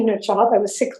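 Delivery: ordinary delivery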